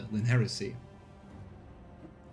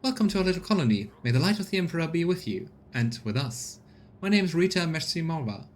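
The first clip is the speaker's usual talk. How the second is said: higher, brisk woman's voice; tired colonist woman; 5 semitones higher than usual. Acted. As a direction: play a tired but proud scavenger boss. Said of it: "then simply says".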